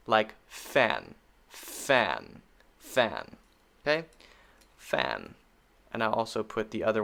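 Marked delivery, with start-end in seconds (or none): 0.5-3.4 fan with short a, fading softer. Shown as a demonstration. Teacher drilling fan for students. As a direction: repeat fan to model short a. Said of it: "the main short sound for A is a".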